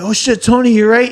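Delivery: high, loud panicked cry; panicked alarm. Retold or imitated. Friends shouting to Tony after the gunshots, high panicked alarm. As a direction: call out in alarm to your fallen friend. Friend just shot at the door.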